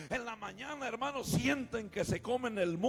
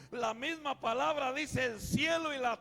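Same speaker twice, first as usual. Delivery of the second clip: full-force preaching; 6 dB louder than usual. Acted. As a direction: preach it out loud to the whole church.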